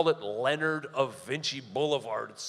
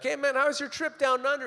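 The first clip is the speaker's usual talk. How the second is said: cheery buddy greeting; 10 semitones higher than usual. Retold or imitated. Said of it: "I was like".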